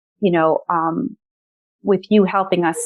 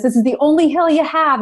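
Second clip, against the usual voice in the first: teasing driver; 7 semitones higher than usual. Retold or imitated. Quoting the driver's encouragement.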